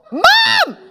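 very high, loud scream for Mom; piercing child's scream. Acted. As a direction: shriek for a parent to step in. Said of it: "screamed Peter".

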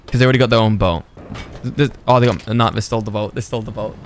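normal talking voice

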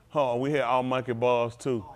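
ordinary talk, nothing marked

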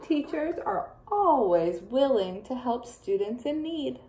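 plain reading voice

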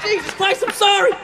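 high, flustered apology; frantic apology. Acted. Owner pulls dog back from a stranger, high frantic apology. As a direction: cry out sorry in a panic.

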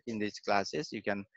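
normal talking voice

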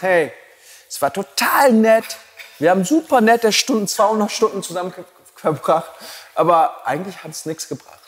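lightly voiced pleased participant; bubbly student quote. Quoting a satisfied but unchanged student. (leicht gespielte begeisterte Teilnehmerin, höher)

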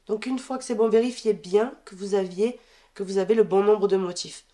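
ordinary delivery

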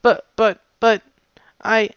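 high, flustered stammer; guard mare stammering, confidence wilting. Acted. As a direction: stumble over words losing confidence. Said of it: "her confidence suddenly wilting".

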